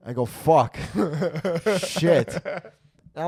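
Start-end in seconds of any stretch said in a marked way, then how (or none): 0.3-0.8 loud curse. Retold or imitated. Realizing girlfriend is downstairs. 1.0-2.4 laughing curse. Real, not acted. Laughing at own panic.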